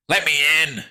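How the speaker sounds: high, loud order; demanding. A narrator's reading. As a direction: demand entry in a yell. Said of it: "he demanded".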